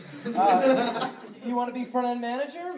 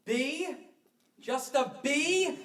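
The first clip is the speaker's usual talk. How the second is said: disappointed surprise; 5 semitones higher, much slower than usual. Acted. Disappointed at rating.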